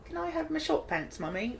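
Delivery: put-on high, soft British child; British child accent. Shown as a demonstration. Host showing off their only accent, put-on British child. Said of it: "That's my only accent".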